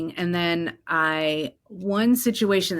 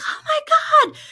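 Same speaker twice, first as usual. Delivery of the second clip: very high, squealing Oh my God; excited mom; 7 dB louder, faster than usual. Retold or imitated. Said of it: "And she's like".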